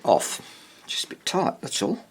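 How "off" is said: ordinary talk, nothing marked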